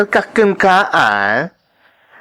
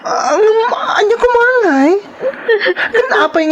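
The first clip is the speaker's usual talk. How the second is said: distressed cry; 13 semitones higher than usual. Acted. Shocked at what happened.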